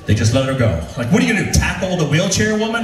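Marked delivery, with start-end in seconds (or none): none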